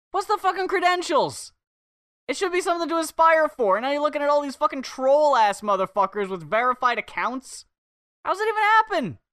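high-pitched incredulous outburst; exasperated ranting. Real, not acted. Ranting to co-host about verified trolls, exasperated and incredulous. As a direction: rant upward in real disbelief.

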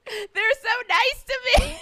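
high, loud, drawn-out weepy wail; hammed-up sob of gratitude, laughing through it; mock happy crying. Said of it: "and I'm crying".

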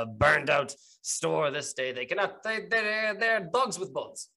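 exasperated captain. Acted. Captain complaining about war staining the streets, exasperated. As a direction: rail against the gang war in character.

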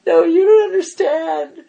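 high, loud teary voice; tearful protest. Retold or imitated. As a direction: plead through tears, half laughing. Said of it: "and I start crying".

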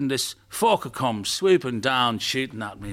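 slow, emphatic Irish storyteller; Irish grandfather. Acted. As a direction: relish the war story in an Irish accent. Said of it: "This Irish grandfather's been invited".

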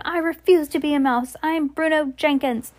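acted indignant refusal; indignant protest. A narrator's reading. Bruno protests being a mouse.

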